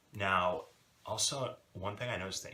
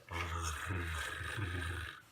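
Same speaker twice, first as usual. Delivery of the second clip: long tongue-tip trill; sustained rolled "rrr" on the roof of the mouth. Shown as a demonstration. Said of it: "you kinda wanna touch the".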